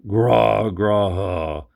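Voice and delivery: loud gnashing growls; monster growl. A narrator's reading. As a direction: snarl like a hungry beast.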